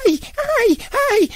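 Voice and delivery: put-on high-pitched street cry in a kid character's voice; mock ugly wailing; imitated ugly cry. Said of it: "Gritaba muy feo".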